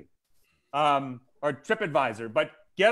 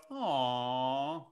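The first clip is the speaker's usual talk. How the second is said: soft, lower, drawn-out coo; imitated sympathetic aw; 5 semitones lower, 8 dB quieter than usual. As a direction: coo it like a touched onlooker. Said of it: "so someone would go".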